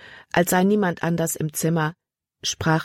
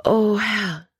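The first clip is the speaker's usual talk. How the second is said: sighing; 5 semitones higher than usual. A narrator's reading. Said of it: "nun seufzte sie".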